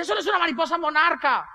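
high-pitched outraged mother; mock indignant protest. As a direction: protest shrilly as an imitated angry mother. Madre protesta por el dibujo. (protesta indignada y aguda, imitada)

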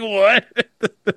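a baffled "what" breaking into laughter; cackling disbelief. Real, not acted. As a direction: laugh through it, can't believe it. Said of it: "'Cause you're cackling right now".

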